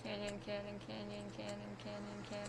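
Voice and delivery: softly rattling off a tongue twister; tongue twister attempt. Player takes up co-host's five-times-fast challenge, softly reciting tongue twister. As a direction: repeat it over and over under your voice, playfully. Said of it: "Say that five times fast".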